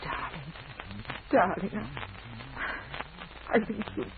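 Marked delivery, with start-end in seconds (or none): 0.0-0.5 soft, breathless near-whisper. Acted. Moved woman whispering to her suitor, breathless. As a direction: barely voice it, overcome.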